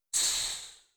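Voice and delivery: soft drawn-out shush; hushing shush. A narrator's reading. Lyovka silently signals his brother across the yard, hushing shush. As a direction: hiss a hush, finger to lips. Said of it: "приложил Лёвка палец к губам".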